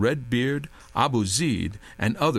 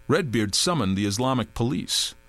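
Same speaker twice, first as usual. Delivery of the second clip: usual speaking voice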